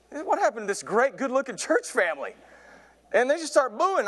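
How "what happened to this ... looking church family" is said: high-pitched, incredulous plea; bewildered dismay. Acted. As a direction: plead in comic disbelief at the crowd. Dream of congregation booing him.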